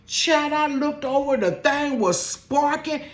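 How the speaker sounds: high, slow, loud near-shriek of disbelief; frantic alarm. Retold or imitated. Telling viewers her skirt caught fire, high frantic alarm. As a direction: sound alarmed as the story peaks.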